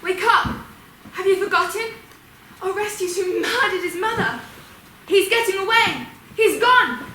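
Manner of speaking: high, urgent cry to sleepers; urgent rousing. Acted. Ghost rousing the sleeping Furies, urgent and high.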